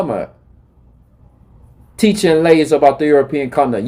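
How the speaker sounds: ordinary delivery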